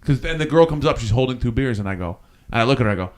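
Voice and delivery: ordinary talk, nothing marked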